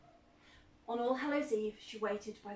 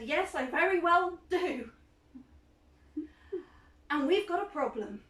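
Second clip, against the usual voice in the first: loud, higher, firm retort; startled girl answering back hard; defiant retort; 5 semitones higher, 8 dB louder than usual. Acted. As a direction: stand your ground and answer back loudly. Janet spins round, retorts.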